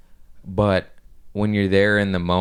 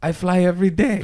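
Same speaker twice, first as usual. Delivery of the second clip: German accent attempt; 12 semitones higher, 3 dB louder than usual. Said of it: "I don't know how to do accents, but I'm gonna try".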